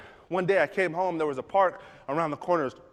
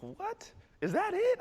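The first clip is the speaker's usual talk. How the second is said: incredulous dad; slower than usual. Retold or imitated.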